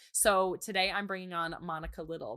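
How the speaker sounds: normal talking voice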